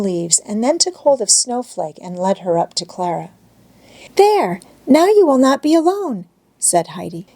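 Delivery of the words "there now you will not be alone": put-on high, bright girl reassuring her friend; reassuring child. A narrator's reading. Heidi settling the goat beside Clara, high reassuring child. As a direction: comfort Clara like a little girl.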